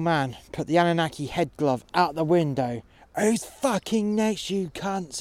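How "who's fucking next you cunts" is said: much higher, slow, taunting yell; shouted. Retold or imitated. Old man wearing the head glove, taunting the army, yelled. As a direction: roar it at the army below. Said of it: "he shouted".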